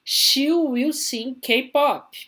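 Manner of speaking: slow, high, loud model reading; model sentence. Shown as a demonstration. Teacher reads the slide example to students, high, slow and loud. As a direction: sing it out high and slow for the class. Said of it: "Olha de baixo aqui".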